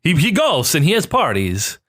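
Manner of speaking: put-on slow, high Italian accent. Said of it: "here's my weird Italian accent".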